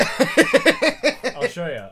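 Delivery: drawn-out, high, loud taunting voice; mock threat. Host taunting Mel Gibson, loud, high, drawn-out mock threat. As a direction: stretch it out like a cartoon bully.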